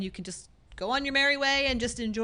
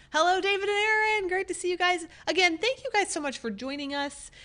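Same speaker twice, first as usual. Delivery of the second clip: excited greeting; 8 semitones higher than usual. Real, not acted. Greeting viewers on a live stream.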